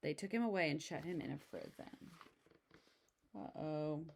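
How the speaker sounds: plain reading voice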